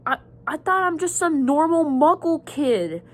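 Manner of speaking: a light, high imitation of a stunned boy; surprised young Harry. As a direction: pitch jumps well up in mock surprise. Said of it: "And Harry's like".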